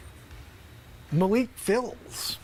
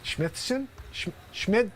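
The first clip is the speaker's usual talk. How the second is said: muffled, softer behind a hand; hand over mouth; 5 dB quieter, slower than usual. Acted. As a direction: mumble into your palm. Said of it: "You're talking into your hand".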